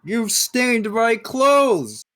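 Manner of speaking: high, slow disgusted whine; affronted noble. Acted. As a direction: sound deeply offended. Noble complains of blood.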